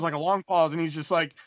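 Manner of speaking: normal talking voice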